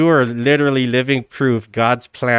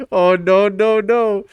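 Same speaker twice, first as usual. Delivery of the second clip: lightly imitated, higher mock dismay; playful echo of a meme; meme imitation; 6 semitones higher, slower than usual. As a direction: climb higher on each no. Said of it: "I forgot all about that meme".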